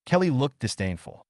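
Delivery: usual speaking voice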